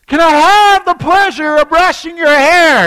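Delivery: full shouted polite question. Retold or imitated. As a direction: shout it across the whole airport. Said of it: "And so she shouts".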